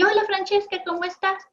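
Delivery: chirpy hello from a friend; cheerful friend. Retold or imitated. Friend calling Francesca, cheery and high. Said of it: "una de sus amigas la llama".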